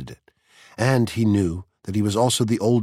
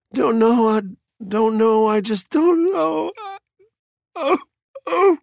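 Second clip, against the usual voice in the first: loud, very high wailing sobs; sobbing; 6 dB louder than usual. A narrator's reading. Missus Phelps cannot explain her tears, wailing sobs. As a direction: wail helplessly, breaking into cries. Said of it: "sobbed Missus Phelps".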